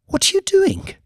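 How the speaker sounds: very high indignant question; woman pushing away his hand, startled. Acted. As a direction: recoil in surprise. She removes his hand.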